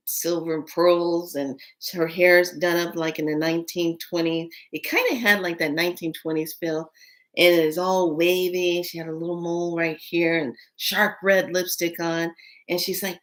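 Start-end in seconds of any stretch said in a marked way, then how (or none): none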